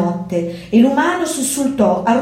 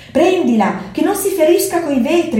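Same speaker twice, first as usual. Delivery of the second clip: urgent mew; 7 semitones higher than usual. A narrator's reading. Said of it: "miagolò Zorba".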